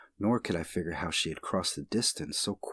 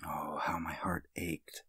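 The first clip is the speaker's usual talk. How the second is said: hushed lament; 2 semitones lower, 7 dB quieter than usual. A narrator's reading. Aching lament.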